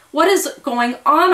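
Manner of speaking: high, loud stunned gasp; gasped in shock. A narrator's reading. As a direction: gasp it out, reeling. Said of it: "I finally gasped".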